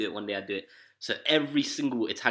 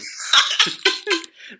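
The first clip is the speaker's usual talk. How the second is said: high breathy burst of laughter; wheezing giggle; 9 dB louder than usual. Real, not acted. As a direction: crack up. Laughing after story.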